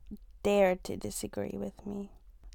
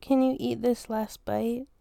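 normal talking voice

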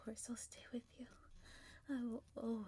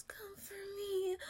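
usual speaking voice

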